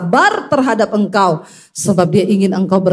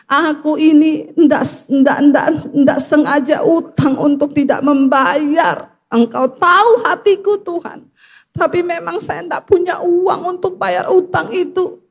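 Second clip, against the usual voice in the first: high-pitched, re-enacted weeping plea; imitated tearful protest of innocence; tearful prayer; 6 semitones higher than usual. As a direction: plead with God as if crying. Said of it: "Saya menangis dan saya berkata".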